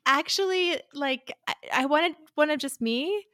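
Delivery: high, hesitant mock-awkward request; confused. Woman asking a stranger for her own photo, confused. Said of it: "she just looks confused".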